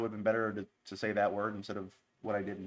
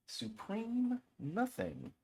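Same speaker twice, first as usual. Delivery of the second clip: drawn-out dramatic title; 11 semitones higher, 7 dB quieter, much slower than usual. Pretend. Announcing song title.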